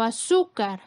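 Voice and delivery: "azúcar" with the force on "zú"; stress on middle syllable. Shown as a demonstration. Teacher gives an example of penultimate stress, "azúcar" stressed on "zú". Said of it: "es la sílaba con mayor fuerza".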